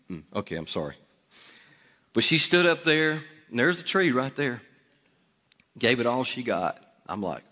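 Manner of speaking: ordinary talk, nothing marked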